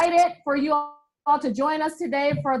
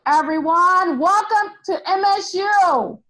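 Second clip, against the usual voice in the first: rally welcome. Real, not acted. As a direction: call out to the whole crowd. Cheerleader welcoming the crowd.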